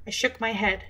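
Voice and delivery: ordinary delivery, nothing marked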